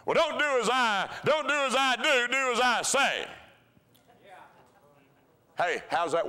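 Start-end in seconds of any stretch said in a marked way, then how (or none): 0.0-3.3 mock parent. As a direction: lecture like a hypocritical parent. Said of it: "we're gonna pull the adult thing".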